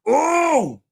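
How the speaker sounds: a high, loud gleeful "ooh"; high delighted squeal after the joke. Real, not acted. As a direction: squeal it out in glee.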